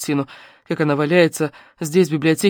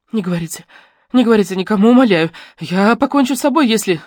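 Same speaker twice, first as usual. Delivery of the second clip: higher, louder, breaking pleas; frantic murmuring plea; 5 semitones higher, 4 dB louder than usual. A narrator's reading. Girl splashing her face, still begging frantically. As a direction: beg again, near hysteria. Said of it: "бормоча".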